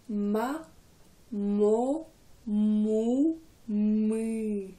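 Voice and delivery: vowels drawn out one by one for repetition; vocal warm-up. Shown as a demonstration. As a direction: model each syllable for the class to repeat. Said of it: "faites comme moi, répétez".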